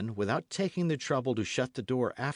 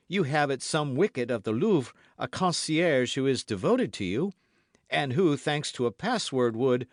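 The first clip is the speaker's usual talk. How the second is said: put-on louder, higher young guardsman; lightly voiced D'Artagnan, eager; 5 semitones higher, 5 dB louder than usual. A narrator's reading. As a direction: press the idea keenly, breaking off at the end. D'Artagnan presses his idea.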